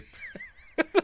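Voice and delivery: high airy giggle; breathy laugh. Real, not acted. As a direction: let out a quick giggle. Laughing at the scene.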